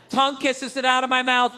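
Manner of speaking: normal talking voice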